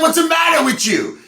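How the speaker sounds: loud high-pitched yell; high shouted outrage. Acted. Client yelling at the escort who peed on him. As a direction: shriek it at her in horror.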